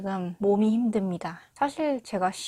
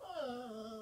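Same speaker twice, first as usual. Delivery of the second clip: sharp startled gasp; shocked gasp; 4 semitones higher, 15 dB quieter than usual. Real, not acted. Shocked on seeing her selfie.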